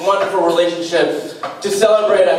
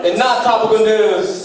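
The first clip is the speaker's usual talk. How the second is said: mock news anchor; 5 semitones higher than usual. Mock newscaster segment opener.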